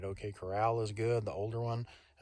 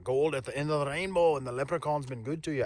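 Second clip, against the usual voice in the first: mock Scottish accent; 4 semitones higher, 3 dB louder than usual. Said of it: "I'm talking Scottish".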